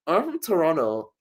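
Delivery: high put-on Canadian voice; fake Canadian accent. Liar claiming a Toronto home, fake Canadian accent. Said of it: "That's how Canadians talk".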